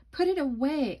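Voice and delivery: high, loud panicked shriek. Acted. Boy shrieking at Carly Beth to put the suit away. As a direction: scream it out in a panic. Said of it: "I shrieked".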